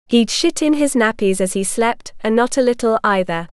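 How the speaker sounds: ordinary delivery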